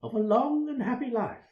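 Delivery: high-pitched, perky question; perky reporter carrying on her question; chirping. A narrator's reading. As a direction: chirp it high, like an eager interviewer. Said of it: "she chirps up".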